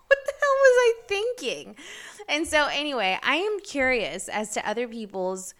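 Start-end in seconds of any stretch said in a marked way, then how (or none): 0.1-1.8 high, loud, exasperated at herself; what on earth was I thinking, loud and high; incredulous outburst. Real, not acted. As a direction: cry out in disbelief at your own past. Repeats disbelief at own stunt.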